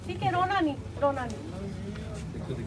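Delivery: soft, dropping low and slow at the end; soothing. Real, not acted. Official comforting a mother in distress, soothing. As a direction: hush her gently. Consoling a weeping elderly woman.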